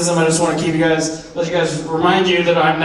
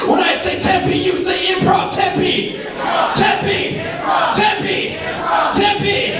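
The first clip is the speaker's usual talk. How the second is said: high shouted crowd chant; call and response; 14 semitones higher, slower than usual. Acted. Comic leading audience call-and-response, shouted chant. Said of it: "get the energy up in the room".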